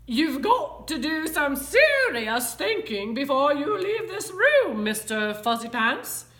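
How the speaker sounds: high, loud motherly telling-off; stern lecture. A narrator's reading. Mother lectures Mister Fuzzy Pants, scolding. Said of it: "The lecture went on".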